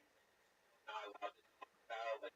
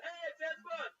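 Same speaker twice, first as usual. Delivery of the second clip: much higher and louder, a gleeful mocking call; excited shout; 5 dB louder than usual. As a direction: shout it out like he's just spotted a celebrity. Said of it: "the guy turns around and goes".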